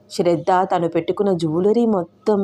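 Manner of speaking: ordinary delivery, nothing marked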